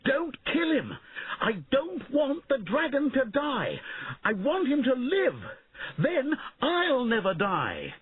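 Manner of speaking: put-on young king begging knights, high and slowing; frantic pleading. A narrator's reading. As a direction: beg them to spare the dragon.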